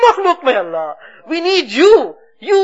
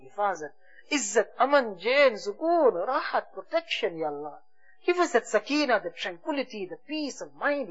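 much softer, fading pleading; hushed tearful plea; 5 semitones lower, 10 dB quieter than usual. Real, not acted. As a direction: sink to a near-murmur of repentance. Closing dua fades to sobs.

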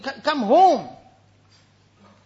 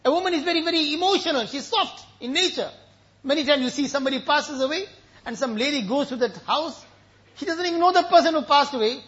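normal talking voice

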